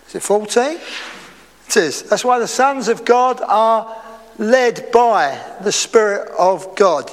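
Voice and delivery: ordinary talk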